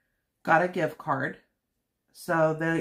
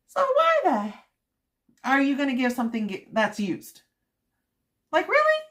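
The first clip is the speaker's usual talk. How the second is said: shrill, much higher disbelief; incredulous rant; 10 semitones higher, 5 dB louder, faster than usual. Real, not acted. Venting to viewers about used gifts, shrill disbelief. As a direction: squeak out your outrage at used gifts. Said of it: "I had to rant".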